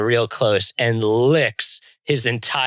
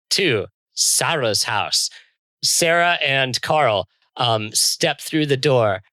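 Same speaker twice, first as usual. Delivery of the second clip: ordinary talk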